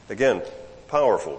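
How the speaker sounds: ordinary talk, nothing marked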